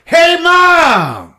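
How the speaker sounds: high, loud, drawn-out call; mock heroic shout. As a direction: holler it like an action hero. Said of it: "and then you just hear".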